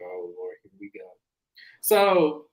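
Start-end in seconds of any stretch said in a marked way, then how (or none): 0.0-1.1 much softer, lower muttered dread; dreading under breath. Retold or imitated. Host watching Lisa, dreading it in a much softer low voice. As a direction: say it under your breath, bracing for drama. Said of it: "I'm looking at Lisa like".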